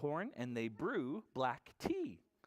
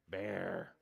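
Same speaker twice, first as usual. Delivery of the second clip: low bear voice; 7 semitones lower than usual. Shown as a demonstration. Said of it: "This is the sign for bear".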